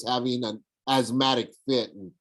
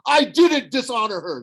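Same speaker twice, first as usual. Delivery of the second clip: higher, louder sharp retort; snapped; 13 semitones higher, 6 dB louder than usual. A narrator's reading. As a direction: snap back defensively. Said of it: "Luke snapped".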